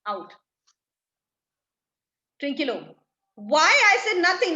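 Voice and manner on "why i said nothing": loud, high-pitched protest; acted shock. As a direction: burst out in bewildered innocence. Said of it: "in a state of shock".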